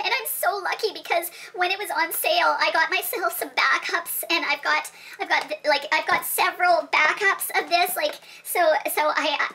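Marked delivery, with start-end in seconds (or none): none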